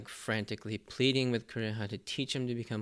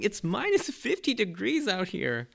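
higher, louder cartoon scream; mock scream; 10 semitones higher, 4 dB louder than usual. Chopper complaining about the cold, higher mock scream.

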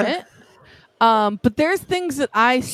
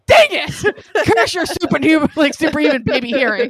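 higher and louder, hammed-up cartoon villain; mock villain curse; 4 semitones higher, 6 dB louder than usual. Aunt foiled by the nephew's hearing, hammy villain rant. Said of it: "And I was like".

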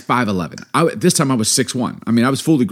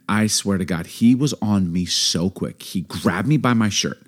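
ordinary talk, nothing marked

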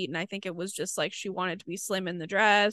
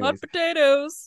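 street vendor call; 7 semitones higher, 7 dB louder than usual. Pretend.

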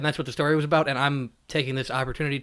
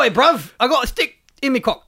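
loud, higher mock cockney bloke; jokey cockney accent, pitched up; 8 semitones higher, 6 dB louder than usual. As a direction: ham up a cockney lad, louder and higher. Mock British lad boast.

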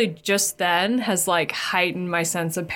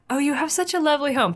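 gushing guest; 7 semitones higher, faster than usual. Retold or imitated. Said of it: "and they're like".

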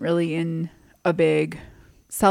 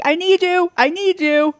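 high, urgent call for help; urgent plea; 10 semitones higher, 3 dB louder than usual. Retold or imitated. Wife calling her husband out to help, high urgent plea. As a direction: plead high through the front door.

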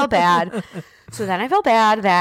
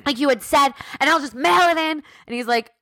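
normal talking voice

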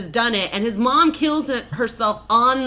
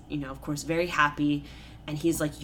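ordinary talk, nothing marked

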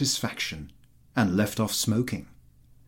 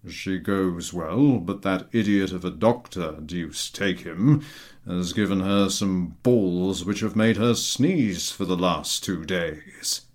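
lower, slow grumbling general; gruff general; 3 semitones lower than usual. A narrator's reading. The general grumbling to Chertakutski about the doctor, lower and slow.

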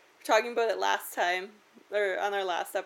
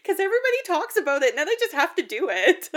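loud sheepish excuse; 8 semitones higher, 7 dB louder, faster than usual. Retold or imitated. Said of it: "And I was like".

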